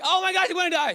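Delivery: high, loud mock panic at the storm; yelling that we're all going to die; panicked cry. Disciples yelling at sleeping Jesus, mock panic.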